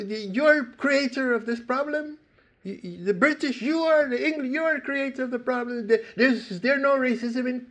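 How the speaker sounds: high, fast accusing reporter; hostile reporter. Retold or imitated. Reporter attacking the English team member, high and fast. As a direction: hurl the accusations rapid-fire. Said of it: "He said".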